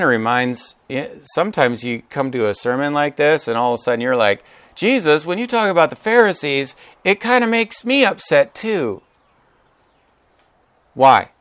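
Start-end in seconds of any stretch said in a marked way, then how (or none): 4.7-9.0 tentative protest creeping higher; mildly acted complaint. Churchgoer objecting to Jesus, mildly acted upset. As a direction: lodge a small put-on grievance, pitch climbing.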